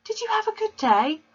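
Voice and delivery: put-on high young girl's polite question. A narrator's reading. Daughter politely asking her father, high girl voice.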